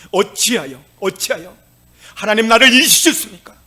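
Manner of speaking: loud cry of despair to God; anguished lament. Acted. Preacher voicing David's anguished cry to God, loud.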